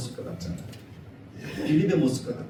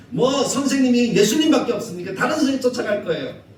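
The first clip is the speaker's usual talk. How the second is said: sulky disciple; 9 semitones higher, 7 dB louder than usual. Pretend. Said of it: "그렇게 하지 않았을까요?".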